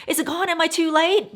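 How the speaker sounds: mock panic. Calling the associate back, anxious high pleading. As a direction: replay your own alarm, high and anxious.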